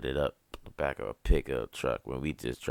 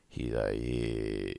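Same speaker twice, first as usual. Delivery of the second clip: a lightly lowered, soft deep-voiced imitation; imitating deep voice; 4 semitones lower, 4 dB quieter, much slower than usual. Imitating how Kendrick talks, in a lightly lowered deep voice. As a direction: drop into his friend's deep slow voice, quietly.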